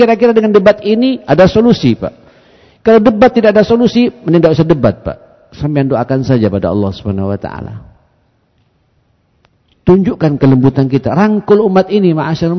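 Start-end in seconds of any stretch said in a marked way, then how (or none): none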